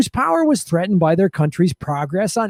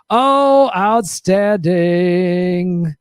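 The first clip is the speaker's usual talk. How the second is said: sarcastic praise; 3 dB louder, much slower than usual. Acted. Host reacting to drug smuggling profits, sarcastic praise. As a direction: stretch it out in mock admiration.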